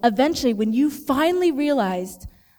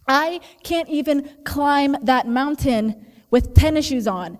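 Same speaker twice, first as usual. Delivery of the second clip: ordinary talk, nothing marked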